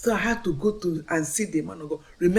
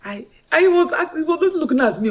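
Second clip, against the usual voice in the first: ordinary delivery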